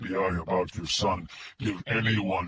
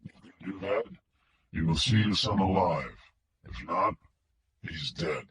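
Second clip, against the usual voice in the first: mechanical filtered voice; 3 semitones lower, 5 dB quieter than usual. A narrator's reading. Kidnapper on the phone to Wingo, mechanical filtered voice. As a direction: speak like a voice through a distortion filter. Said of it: "The filtered voice was mechanical".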